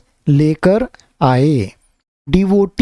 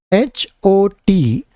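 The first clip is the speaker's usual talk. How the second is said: slow spelling; slower than usual. Shown as a demonstration. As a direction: spell H O T out letter by letter, slowly. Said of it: "मैं spelling भी बोलते जा रहा हूँ".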